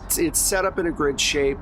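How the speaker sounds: normal talking voice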